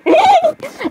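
very high squealed laugh; high-pitched giggle. Acted. Laughing amid acted scene.

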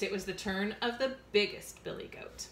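ordinary delivery

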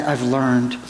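usual speaking voice

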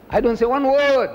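"one word" high and forceful; emphatic repeat. Real, not acted.

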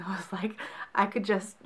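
breathy hushed whisper, unvoiced; whispered aside. Real, not acted. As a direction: drop to a confiding whisper. Aside about tissues, hushed.

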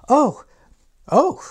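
high, loud burst of mock surprise; teacher feigning shock at the text. Real, not acted. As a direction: gasp out a hammed-up oh. Mock excitement at the novel.

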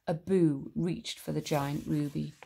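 plain reading voice

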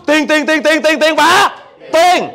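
TIỀN hammered out over and over, high and loud; insistent repetition. Retold or imitated. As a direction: punch each "tiền" like a relentless refrain. Mimics women's constant money worry. (nhấn dồn dập từng chữ "tiền", giọng cao và to)

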